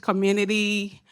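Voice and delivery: ordinary delivery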